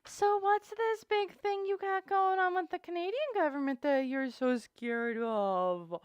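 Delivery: high singsong taunt; mock-concerned teasing. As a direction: tease with put-on sweetness. Teasing Chantal over her fear.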